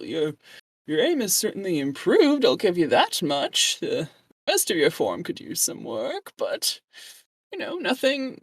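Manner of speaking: put-on high, wavering, condescending old man. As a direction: sneer at her like a smug old priest. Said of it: "you're enjoying my old man voice?".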